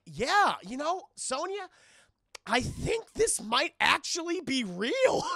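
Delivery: high, loud and slow, laughing; giddy disbelief. Retold or imitated. Johnny Cage telling Sonya it's real, giddy. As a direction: gasp that it's all real. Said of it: "He's like".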